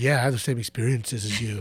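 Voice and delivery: low, soft creepy stranger voice; low, creepy keyboard-warrior voice. Acted. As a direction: play the creepy online stranger, dropped low. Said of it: "I don't know why I do the creepy voice".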